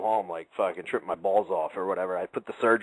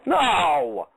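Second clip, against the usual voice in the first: loud, high burst of disbelief; a listener reacting to a finger thrown in the trash; shocked no; 9 semitones higher, 5 dB louder than usual. Real, not acted. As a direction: blurt it out in real shock.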